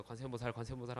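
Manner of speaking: soft, hushed 관세음보살 of thanks; relieved murmur. A narrator's reading. Wife thanking Buddha after husband survives, soft and relieved.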